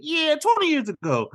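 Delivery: very high kid storyteller imitation; Squints. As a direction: pitch it way up, dramatically sure of the legend. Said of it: "He's like".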